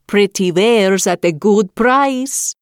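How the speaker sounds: put-on slow, creaky old crone calling; old peddler woman voice. A narrator's reading. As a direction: call out your wares like an old hag. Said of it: "called out".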